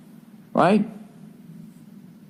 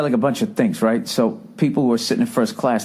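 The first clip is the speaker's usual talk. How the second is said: ordinary talk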